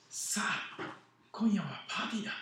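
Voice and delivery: overjoyed father shouting the news; joyful loud call. Acted. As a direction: announce the party to the whole household. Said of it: "大声で言った".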